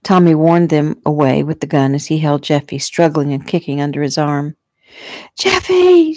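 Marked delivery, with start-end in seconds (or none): 5.4-6.1 very high, anguished call; mother's scream. A narrator's reading. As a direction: scream out the child's name. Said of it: "Shelley screamed".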